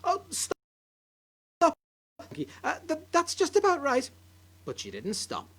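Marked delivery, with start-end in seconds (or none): 0.0-4.1 shrill grandma. Acted. Grandma pleads to stop.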